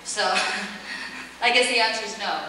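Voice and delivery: usual speaking voice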